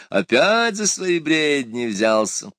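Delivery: high, drawn-out jovial teasing; merry exclamation. A narrator's reading. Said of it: "весело воскликнул император". (весёлое протяжное восклицание)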